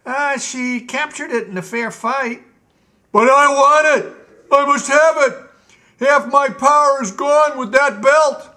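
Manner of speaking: high, loud, whiny demanding king; a spoiled tyrant wanting his toy back; petulant Gnome King. Acted.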